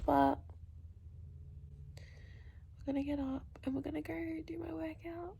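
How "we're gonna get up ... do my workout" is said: hushed whisper so no one wakes; breathy whisper under the covers; early-morning whisper. Real, not acted.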